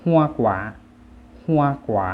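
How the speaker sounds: ฮัวกวา as Vietnamese, high then dropping; Vietnamese pronunciation. Shown as a demonstration. Teacher models the word for fruit, Vietnamese pronunciation of ฮัวกวา. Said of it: "ภาษาเวียดจะอ่านว่า".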